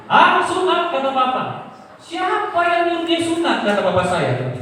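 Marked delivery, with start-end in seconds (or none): 0.1-0.9 high, loud shocked exclamation; angry outburst. Retold or imitated. Father hears his son was circumcised, angry outburst. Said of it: "Iya, dia marah-marah memang". 2.0-3.6 high-pitched indignant demand; angry father. Retold or imitated. Father demanding who allowed the circumcision, angry. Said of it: "Iya, dia marah-marah memang".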